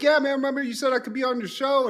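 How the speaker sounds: fast, high eager pitch; eager reminder. Retold or imitated. Martin reminding Varnell of his promised show spot, fast and eager. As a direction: rush it out hopefully.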